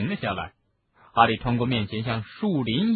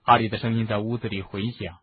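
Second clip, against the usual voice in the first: ordinary delivery, nothing marked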